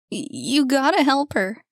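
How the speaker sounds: high, loud broken plea; sobbing plea. Acted. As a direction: beg through tears. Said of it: "The sobbing took over".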